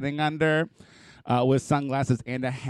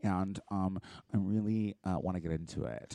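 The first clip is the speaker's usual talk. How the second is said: timid fan voice; 6 semitones lower, 10 dB quieter than usual. Retold or imitated.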